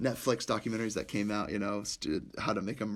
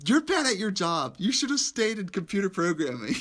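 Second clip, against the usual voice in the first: scolding chief; 7 semitones higher, 4 dB louder than usual. Retold or imitated. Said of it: "He goes".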